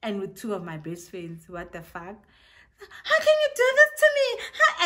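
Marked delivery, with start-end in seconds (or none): 3.0-4.9 high, loud, laughing-crying mock despair; betrayed wail. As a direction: wail it like a hammed-up soap heroine. Betrayed girl confronts boyfriend.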